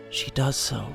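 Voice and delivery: usual speaking voice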